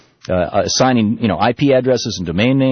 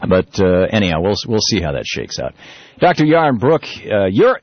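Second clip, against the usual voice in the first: normal talking voice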